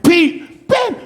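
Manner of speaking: high excited double call; excited shout. Retold or imitated. Two mates running to each other, excited shout. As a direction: build to a thrilled reunion cry. Greeting friend across street.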